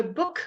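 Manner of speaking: book with a brief u, not a long oo; book, quick vowel closed by the k; short vowel. Shown as a demonstration. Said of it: "keep it short".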